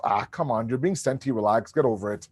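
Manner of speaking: low, soft brush-off; dismissive. Acted. Father blowing off his thirteen-year-old, dismissive. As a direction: wave it away, mildly impatient. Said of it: "I blow it off".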